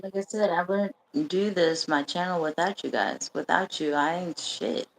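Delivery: ordinary talk, nothing marked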